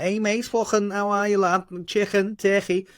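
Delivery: high-pitched, hammed-up Scouse impression; Liverpool accent. Showing the host how he mimics locals, exaggerated Scouse accent. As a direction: throw on a cartoonish Liverpool accent. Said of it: "If you put me in Liverpool".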